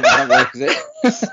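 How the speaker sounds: high, laughing through the words; cracking up. Real, not acted. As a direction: barely get it out for laughing, slow and loud. Cracks up at own failed joke.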